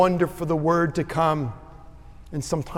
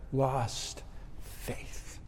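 softer, breathless whisper; 3 semitones lower, 11 dB quieter than usual. Real, not acted. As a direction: let the words fall to a whisper. Tender climax of the story.

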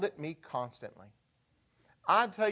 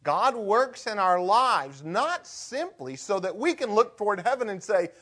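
normal talking voice

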